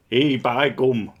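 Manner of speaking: loud, hearty northern English by gum; Northern England accent. Pretend. Mocking flat earthers in a broad Northern England accent. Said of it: "accent that I think of".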